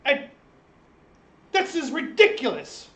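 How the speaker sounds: high loud protest with a laugh in it; a guest caught out, loudly protesting his innocence; indignant denial. Acted. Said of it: "the speaker looks at him and goes".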